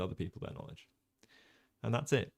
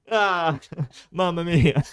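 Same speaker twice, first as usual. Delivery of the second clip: higher, louder hammed-up Italian "mamma mia"; playful Italian outburst, higher and louder; mock Italian exclamation; 9 semitones higher, 11 dB louder, slower than usual. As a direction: throw it up like a cartoon Italian. Joking about streaming in Italian.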